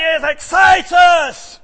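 slow, loud, drawn-out exhortation; preaching climax. Real, not acted. Preacher firing up his congregation, slow loud exhortation. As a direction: lean into it and preach it.

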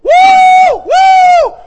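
two loud, high whoops; hollered woo. Retold or imitated. Street preacher whooping to passersby, loud high whoops.

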